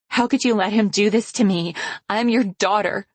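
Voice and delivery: wounded and furious at once; anguished accusation. Acted. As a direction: daughter accusing her mother of betrayal.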